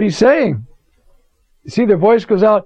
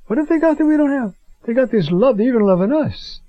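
lightly voiced, higher, quick puzzled question; puzzled Palestinian voice; 6 semitones higher than usual. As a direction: act out the prayer group asking, half amazed. Preacher voicing Palestinian prayer group.

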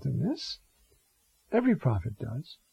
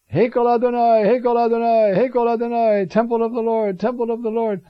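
higher and louder, chanted outcry; prophetic cry; 8 semitones higher, 5 dB louder than usual. Retold or imitated. Jeremiah crying out before the temple of the Lord, loud chanted proclamation. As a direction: proclaim it repeatedly, ringing over the temple court.

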